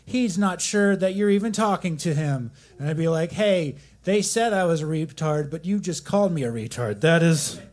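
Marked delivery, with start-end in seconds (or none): 3.3-7.6 raised, louder mock indignation; mock angry rant. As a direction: rant it like you're fed up. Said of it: "I'm gonna say it a lot because I'm mad".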